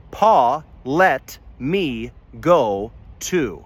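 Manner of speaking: "Pa, let me go to" said very slowly, each vowel clear; drawn-out mnemonic, every vowel pure; memory phrase. Shown as a demonstration. Teacher slowly spelling out the vowel phrase. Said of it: "Just remember the phrase".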